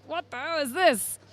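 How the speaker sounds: a lightly voiced old British sailor, baffled; mock outrage at a strange beer, voice barely changed. Time-travelling sailor tastes American IPA, lightly voiced bafflement. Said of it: "he'd be like".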